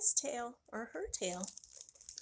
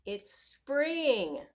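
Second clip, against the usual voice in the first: excited announcement; 2 semitones higher, 8 dB louder than usual. Acted. Joyful announcement to children.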